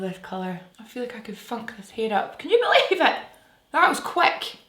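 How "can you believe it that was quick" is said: high-pitched, louder amazed exclamation; excited amazement. Real, not acted. Vlogger amazed the makeup was quick, excited amazement. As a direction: exclaim it high, in delighted disbelief.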